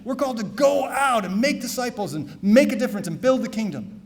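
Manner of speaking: normal talking voice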